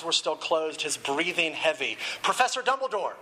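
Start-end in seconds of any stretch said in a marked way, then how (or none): 2.2-3.2 higher, urgent call to the professor; lightly acted strained worry. A narrator's reading. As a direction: raise the pitch in mild alarm. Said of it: "his voice strained".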